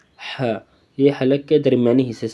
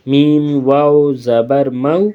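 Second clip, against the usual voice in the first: slow, drawn-out letter-by-letter spelling; 3 dB louder, much slower than usual. Shown as a demonstration. Teacher spells the word slowly for the children. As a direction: chant each letter and vowel slowly, like a primer drill. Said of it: "دھیان سے پڑھنا ہے".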